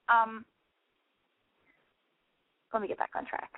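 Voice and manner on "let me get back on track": softer, hushed whisper; breathy hushed self-reminder. Real, not acted. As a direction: whisper it to yourself. Hushed aside to herself.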